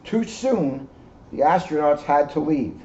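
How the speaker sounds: plain reading voice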